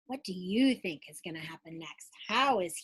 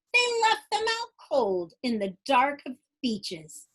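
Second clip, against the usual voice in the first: lively rhyming storybook read-aloud; bouncy Dr. Seuss-style read-aloud; reading to children; 5 semitones higher, 7 dB louder, slower than usual. Acted. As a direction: read it like bedtime story time for kids. Reads picture book aloud.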